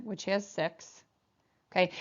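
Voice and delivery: usual speaking voice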